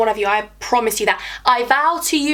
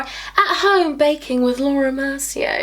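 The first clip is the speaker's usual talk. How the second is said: mock-posh English, slowing on the name; posh accent; 2 semitones higher, slower than usual. Vlogger plays baking host with her powder, posh accent. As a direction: announce it in a put-on posh accent. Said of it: "Does actually sound quite posh".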